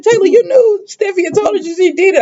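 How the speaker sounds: a lightly voiced shocked Taylor. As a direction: play the mother hearing a confession in shock. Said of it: "Taylor over there in shock".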